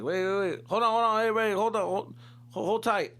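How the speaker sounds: high, loud order to hold on; cop calling out. Retold or imitated. Cop shouting at the teens to hold tight, high and loud. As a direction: stop the kids from leaving.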